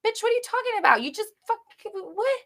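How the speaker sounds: high, squeaky mock disbelief; a shrill, exaggerated "what?"; incredulous sass. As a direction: play the outraged friend. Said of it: "It's like".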